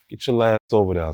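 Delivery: usual speaking voice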